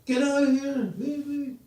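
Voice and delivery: high-pitched panicked yell, imitating a dying man; acted-out cry to be left alone. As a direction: yell it high, driving someone away. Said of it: "He was yelling out".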